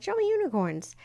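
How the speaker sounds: said once, then let go; light request. Shown as a demonstration. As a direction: toss the wish off lightly. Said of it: "I just said".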